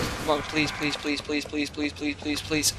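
hushed frantic begging; desperate pleading. Real, not acted. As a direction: beg under your breath. Desperate quiet pleading while chased.

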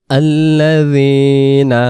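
আল্লাযীনা drawn out slowly, long vowels; slow recitation. Shown as a demonstration. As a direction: stretch আল্লাযীনা like Quran reading. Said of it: "এটাকে আমরা খেয়াল করব". (আল্লাযীনা ধীরে টেনে পড়া)

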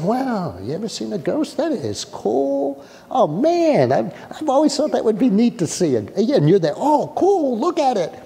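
treat the ghost as a neat sight; mock curious delight. Pastor contrasting fearless and fearful reactions, amused delight. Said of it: "In one saying".

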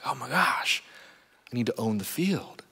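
hushed, scheming whisper; urgent whisper. Retold or imitated. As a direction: keep your voice down while plotting. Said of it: "It's like".

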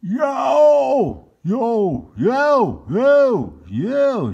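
very loud high yells; loud shouts. Acted. The Pilgrim men yelling in the briar patch. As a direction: bellow it over and over. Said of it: "shouted the ten best men".

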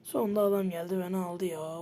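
normal talking voice

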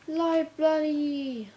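loud, high, drawn-out exclamation; excited cry. Real, not acted. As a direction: stretch it out in a slow, high shout of discovery. Spots a library in the village.

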